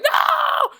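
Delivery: high, loud panicked no; kids screaming no. Retold or imitated. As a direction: scream it like a terrified kid. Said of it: "all the kids are like".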